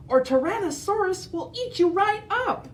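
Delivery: high, pressing warning; urgent warning. Acted. Parasaurolophus warning Edna, high urgent voice. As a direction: warn her fast and high.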